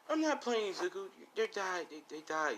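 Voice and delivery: high, softened pleading voice; acted distress. Aunt breaking news of deaths to nephew, acted distress. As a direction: insist in a high, upset voice. Aunt insists the parents died.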